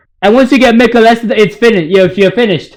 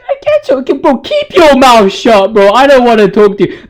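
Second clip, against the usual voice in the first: squeaky, much higher mocking voice, slowed down; 5 semitones higher than usual. [name] telling Gideon to shut up, in a high mocking voice. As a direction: ham up a high taunting voice at Gideon.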